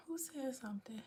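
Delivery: whispered aside; secretive whisper to the chat. Real, not acted. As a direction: hush it under the breath. Aside to audience.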